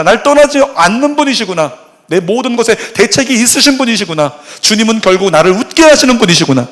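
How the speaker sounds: full-force Korean sermon; impassioned preaching. Acted. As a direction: preach it with rising conviction.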